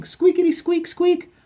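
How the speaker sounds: very high, loud toy squeaks; squeaky toy imitation. Dog chewing its toy, high piercing squeaks.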